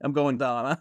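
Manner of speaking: "Dawn" sounding like dawn; Pennsylvania Dutch down. Retold or imitated. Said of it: "It's like".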